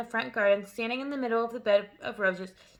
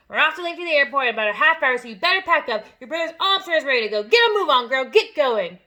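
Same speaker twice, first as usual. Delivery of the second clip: loud, fast, barking orders; impatient father; 4 semitones higher, 11 dB louder than usual. A narrator's reading. Father ordering Matilda to pack, loud and impatient. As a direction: hurry her up harshly.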